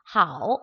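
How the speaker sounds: 하오 dipping low, then rising; low, scooped third-tone 하오; third-tone Mandarin. Shown as a demonstration. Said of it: "A 위에다가 삼성".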